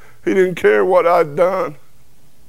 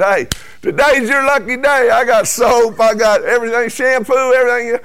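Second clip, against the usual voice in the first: teasing cellmate; 7 semitones higher than usual. Retold or imitated. Teasing cellmate about shower.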